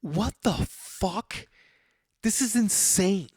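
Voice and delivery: high, slow, drawn-out outrage at the mess; retold disbelief. To the bar full of cigarettes, appalled slow disbelief. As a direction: stretch it out, appalled. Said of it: "And I was like".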